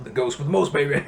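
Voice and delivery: a loud Beetlejuice imitation. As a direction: shout it like a ghoulish showman. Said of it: "kinda sounds like this".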